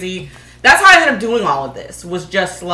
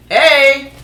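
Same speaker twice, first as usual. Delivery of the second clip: usual speaking voice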